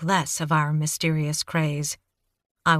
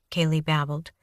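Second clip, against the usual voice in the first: usual speaking voice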